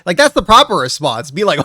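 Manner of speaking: ordinary delivery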